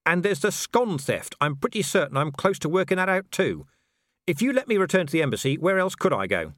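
a man defending the obvious; exasperated Vimes. A narrator's reading. Vimes pleads his case.

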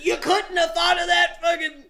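high, loud comic outrage; exasperated yell. Acted. Viewer railing at the hero's late realization, exasperated shout. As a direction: yell it in hammed-up disbelief. Exasperated outburst at the film's moral.

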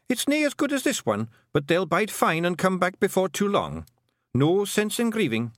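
high, brisk Scottish-dialect character voice; cheerful Feegle. A narrator's reading. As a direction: brush off grief in a high, cheery voice. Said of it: "said Rob Anybody cheerfully".

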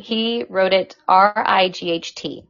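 normal talking voice

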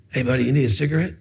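low, soft, quick vendor's offer; imitated furtive whisper. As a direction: mimic a street hawker keeping it discreet. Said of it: "he's whispering to you".

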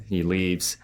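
ordinary talk

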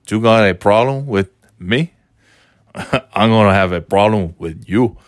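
put-on light Cuban-tinged tough-guy accent; mild mock menace, a laugh breaking in; Razor Ramon voice. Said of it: "talking like this, man".

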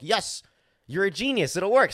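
high, loud delighted praise; overjoyed thanks to the helper; elated, building to a shout; excited relief. Retold or imitated.

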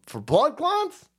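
high, slow mocking singsong; sarcastic mimic. Jeering at the vaccine report, high slow mockery. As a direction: drag it out, dripping sarcasm.

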